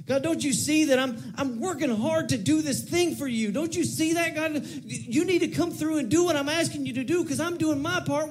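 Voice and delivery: very high, indignant mock complaint to God; demanding attitude. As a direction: press God to hold up his side, exasperated. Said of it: "Maybe not with that attitude".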